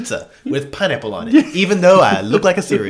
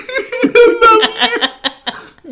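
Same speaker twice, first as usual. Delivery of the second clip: high, very slow drawn-out lovestruck gush with laughter; mock swooning; 6 dB louder, much slower than usual. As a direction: melt into a hammed-up dreamy confession. Swooning reply to lover's greeting.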